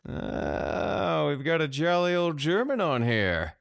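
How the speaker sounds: high-pitched amused squeal; giggling delight. A narrator's reading. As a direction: crow with giggly glee. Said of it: "Samir giggled".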